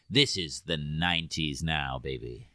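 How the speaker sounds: low, lightly put-on cool pitch, dropping away; studio hype voice. Co-host mimicking the studio updating the character, mock-cool.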